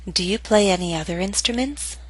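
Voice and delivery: "Do you play any other instruments?" rising at the end; rising intonation. Shown as a demonstration. Teacher models question to repeat, rising intonation. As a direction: lift the pitch through "instruments". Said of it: "practice intonation patterns and questions".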